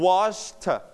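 wash plus a full separate "เธอ" sound; "wash เธอ", the t released as an extra syllable; final t as a syllable. Shown as a demonstration. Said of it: "we pronounce เธอ".

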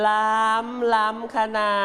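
teacher models the word for tasty in a very slow northern Thai drawl. Shown as a demonstration. Teacher demos "ล้ำ ล้ำขนา" to the class, slow northern Thai drawl. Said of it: "ใส่สำเนียงช้าๆ เนิบๆ ไปด้วย".

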